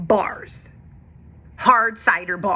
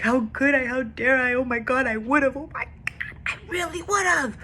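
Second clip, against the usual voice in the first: high, tearful self-reproach; mock sob of regret; imitating crying; 6 semitones higher than usual. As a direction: replay the tearful breakdown, pitched up. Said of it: "like, crying".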